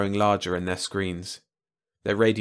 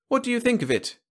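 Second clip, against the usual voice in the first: high-pitched, expectant alien voice; eager Malan host. A narrator's reading. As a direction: ask keenly, voice pitched way up.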